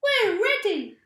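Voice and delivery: high, loud chirpy reply; eager birds. A narrator's reading. Birds answering Mugglewump, high loud eager reply. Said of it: "said the birds".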